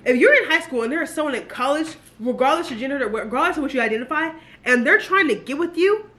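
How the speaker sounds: ordinary delivery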